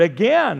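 "Again" punched loudly; stress on Again. Shown as a demonstration. Preacher savoring the word's emphasis, "Again" said loud and stressed. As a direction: hit "Again" hard, the second syllable strong. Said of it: "I like the emphasis on that word".

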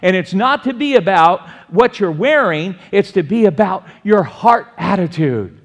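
loud, high-pitched, emphatic sermon delivery; forceful preaching. Real, not acted. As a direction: preach it out at full voice to the whole room.

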